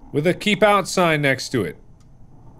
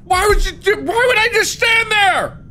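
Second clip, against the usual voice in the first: pained yelp. Real, not acted. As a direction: yelp like you got hit. Hit in the face, yelping.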